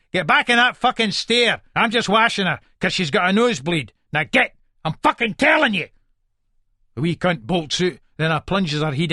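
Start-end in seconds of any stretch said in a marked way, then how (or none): 0.1-5.9 raised-pitch, impatient fatherly command; angry order. Acted. Father sends son back out, angry. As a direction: order the child off angrily.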